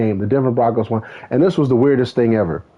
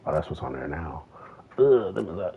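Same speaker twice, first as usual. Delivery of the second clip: normal talking voice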